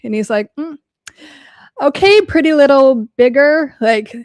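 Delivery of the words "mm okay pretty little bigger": higher, louder sneering taunt; mock sneer. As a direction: sneer it like a jealous host. Imitating Andy Dick.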